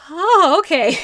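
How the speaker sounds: loud, high mock "oh, now I get it"; big high-pitched dawning-on-you reaction; acted sudden realization. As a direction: burst out with exaggerated understanding. Acts out reaction to spelled-out name.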